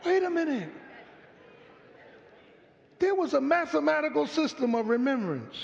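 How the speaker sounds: usual speaking voice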